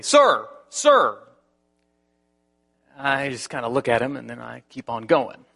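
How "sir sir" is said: calling out loud and higher; retold yell. Security guard yelling after the pastor crossing the street. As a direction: hail him across the lot. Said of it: "somebody yells at me".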